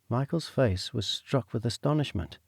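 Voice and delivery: ordinary delivery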